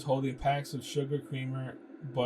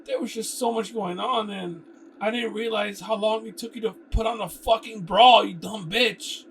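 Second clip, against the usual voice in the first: angry outburst; 9 semitones higher, 10 dB louder than usual. Acted. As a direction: lash out at her. Character yells an insult at Kara.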